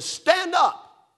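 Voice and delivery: sharp, high, loud command; imitating a drunk gunman's order; barked order. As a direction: bark it like a man holding a shotgun. Man with shotgun orders.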